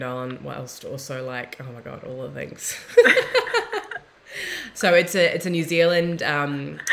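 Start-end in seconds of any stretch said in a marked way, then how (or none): 2.8-4.0 high, loud burst of laughter; a bright laugh at her own confession; high giggle bursting out loud; embarrassed laugh. Real, not acted. Laughs after saying turned on. 4.7-6.2 louder, still laughing as she talks; words carried on a laugh. Real, not acted. Laughs while starting to explain.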